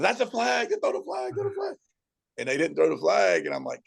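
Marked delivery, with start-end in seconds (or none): 0.0-1.8 screaming at the TV. Retold or imitated. Coach yelling at the TV over a missed flag, high-pitched mock yell. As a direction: replay your outrage at the ref, voice shooting up high. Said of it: "I screamed at television".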